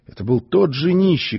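plain reading voice

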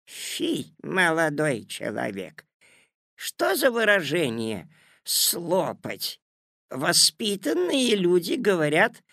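prim grandma voice. A narrator's reading. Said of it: "сказала".

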